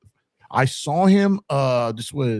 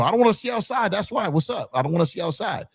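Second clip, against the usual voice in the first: much higher whiny insisting; 9 semitones higher than usual. Retold or imitated. Answering the marshal, high and whiny. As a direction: insist like a petulant kid. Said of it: "Why are you so upset with the window".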